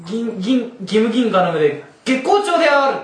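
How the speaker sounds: loud, high anime hero cry; screaming attack name. Pretend. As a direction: yell the finishing move. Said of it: "必殺技を叫べ".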